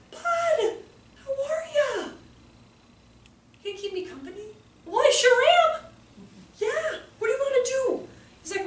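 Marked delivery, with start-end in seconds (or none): 0.1-4.5 high-pitched, sing-out hello; delighted greeting. Retold or imitated. Teacher walking into boy's classroom, high delighted greeting. 4.8-8.1 high-pitched eager agreement; eager companion. Retold or imitated. Teacher keeping a little boy company, high eager voice.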